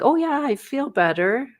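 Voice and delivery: high, chirpy, overly bright claim; fake reassurance. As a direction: brush it off too brightly. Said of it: "sometimes you're like".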